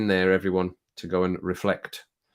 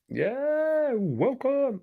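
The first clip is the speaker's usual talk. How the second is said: very high squeaky falsetto welcome; mock welcome; 3 dB quieter, much slower than usual. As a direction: squeak it like a silly greeter. Mock welcome with brown hands.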